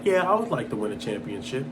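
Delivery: soft, low, indifferent mock quote; lukewarm. Speaker mimicking someone with no drive, lukewarm. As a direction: shrug it off quietly, barely caring. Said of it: "a lack or lukewarm emotion".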